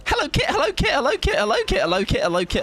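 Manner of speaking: squeaky greeting that sinks a little with every repeat; very high hello sliding down. Shown as a demonstration. Greeting a viewer on request, very high and sinking. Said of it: "say hello to me".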